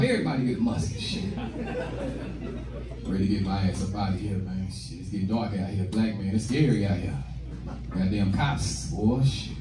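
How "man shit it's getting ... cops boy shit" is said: ordinary talk, nothing marked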